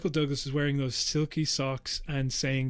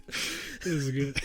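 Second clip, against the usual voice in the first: laughing; 3 dB quieter, much slower than usual. Real, not acted. Still laughing at sketch.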